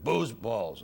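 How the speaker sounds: lightly imitated mean caller, low and emphatic. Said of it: "the meanest voice I ever heard".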